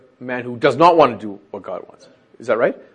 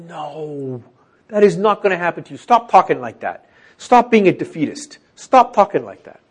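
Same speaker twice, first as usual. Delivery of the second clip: insistent rebuke; 5 semitones higher, 5 dB louder than usual. Acted. Peter rebukes Jesus.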